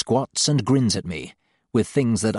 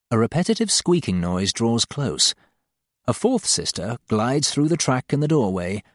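ordinary delivery, nothing marked

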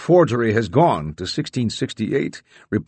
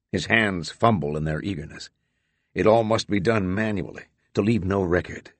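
ordinary delivery

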